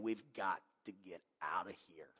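low, very soft retold warning; subdued, ominous undertone; hushed urgency. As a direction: drop low and quiet, as if confiding. Retelling his urge to leave.

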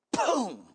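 explosive "boom" falling in pitch; vocal crash effect; imitated crash. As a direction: hit it suddenly, high then dropping.

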